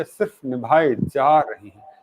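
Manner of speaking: slow line, leaning hard on जा; stress on जा. Real, not acted. Host laments relationships merely dragged along, जा stressed forcefully. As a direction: hit the word जा with force and draw it out. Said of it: "जा को मैं इसलिए जोर से बोल रहा हूँ".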